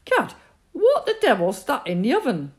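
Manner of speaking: high, loud exasperated outburst; angry father. A narrator's reading. Father finds a kitten in the oven, loud and exasperated. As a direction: bellow in disbelief at the oven.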